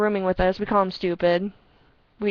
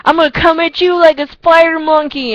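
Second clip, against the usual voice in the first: high loud hammed-up quote; mock spider monkey threat; movie quote voice; 8 semitones higher, 9 dB louder than usual. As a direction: fling out the quote like a cartoon. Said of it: "they're like".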